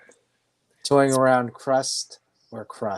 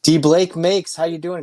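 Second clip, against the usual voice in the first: higher, louder hype greeting; upbeat host shout-out; excited shout-out; 6 semitones higher, 5 dB louder than usual. Real, not acted. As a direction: call his name out like a big welcome. Shout-out to a chat viewer.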